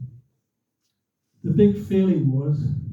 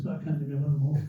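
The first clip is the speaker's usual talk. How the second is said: ordinary delivery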